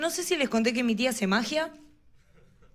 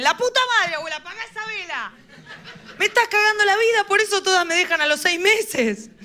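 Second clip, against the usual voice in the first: exasperated outburst; 7 semitones higher than usual. Retold or imitated. Exasperated reply to grandma.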